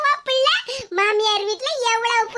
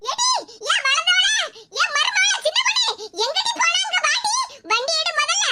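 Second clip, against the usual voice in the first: high-pitched, agitated summons; urgent calling out; 5 semitones higher than usual. Acted. Mother summoning her daughter-in-law to get the bike, urgent calling out. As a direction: call someone over in a panic.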